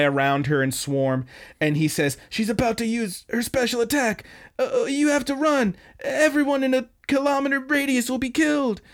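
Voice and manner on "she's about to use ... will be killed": high, strained hammed-up desperation; mock panicked warning. Bloodied gangster warns his waiting gang off, mock panicked warning. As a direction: plead with them to flee, theatrically. Feigned warning of special attack.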